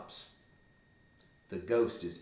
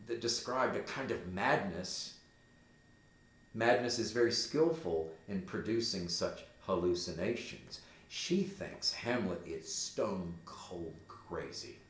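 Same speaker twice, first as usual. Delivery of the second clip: usual speaking voice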